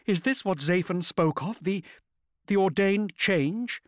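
high, anxious woman, faltering; falter, then stretch out the last words fearfully; worried question in a high character voice; rising panic. A narrator's reading. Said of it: "no concealing her concern or her rising panic".

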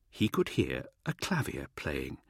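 soft, low, distant echo; hushed echo. A narrator's reading. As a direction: let it drift like a memory. Echoed, dreamlike repeat.

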